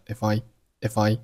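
"if I" run together as i-fai; consonant-vowel linking. Shown as a demonstration. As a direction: slide the f of "if I" onto the I, slowly. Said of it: "if和I发生了辅元连读".

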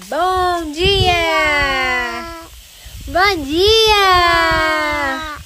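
high, loud sing-song greeting; child prompt. Shown as a demonstration. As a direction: coax a toddler to repeat it. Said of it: "Maria, fala assim".

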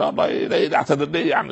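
proud husband refusing to apologize, a fast high scoff; dismissive scoff. Retold or imitated. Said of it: "يقول".

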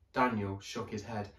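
ordinary delivery

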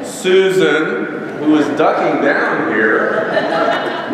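ordinary talk

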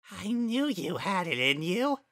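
high, sing-song creepy gloat; gloating flower. Acted. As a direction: savour the moment. Flowey gloats as knife rises.

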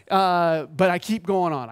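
ordinary delivery

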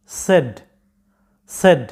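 সেড as sed, short e; correct pronunciation. Shown as a demonstration. Teacher corrects students' word, correct pronunciation. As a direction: model সেড short, no glide, falling. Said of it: "এরকম".

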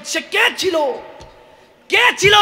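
anguished outcry. Acted. Holy man cries out asking who he was. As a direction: rise into a desperate question. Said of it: "চিৎকার দিয়ে উঠলেন".

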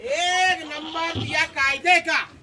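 slow, drawn-out hype call; showman's catchphrase. Real, not acted. Goat seller praising his prize goat, drawn-out catchphrase. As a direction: stretch each word like a showman.

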